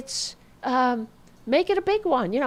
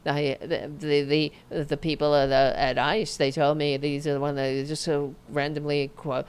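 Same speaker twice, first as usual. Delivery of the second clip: low, rushed monotone mimicry; mock deadpan, rambling on without inflection; emotionless official imitation; 7 semitones lower than usual. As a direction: rattle it off low with no feeling. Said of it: "With no emotion".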